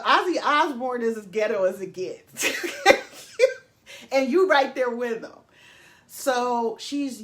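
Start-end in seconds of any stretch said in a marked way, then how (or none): 0.1-5.3 laughing roast. Real, not acted. Roasting Sharon while laughing.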